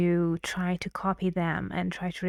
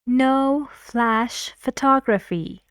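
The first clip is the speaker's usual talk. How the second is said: "No flash photography" slow, higher and louder, each word stressed with pauses; serious, with pauses; 5 semitones higher, 5 dB louder, slower than usual. Shown as a demonstration. Teacher imitating a serious warning, slow stressed words. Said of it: "with a lot of stress, with a lot of pauses".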